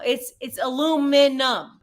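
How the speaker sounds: "aluminum" broken into a-loo-min-num, each syllable set apart; emphatic American pronunciation. Shown as a demonstration. American having the last word against the learner, emphatic American pronunciation. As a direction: spell out "aluminum" syllable by syllable, no extra i. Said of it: "Don't you dare say aluminium".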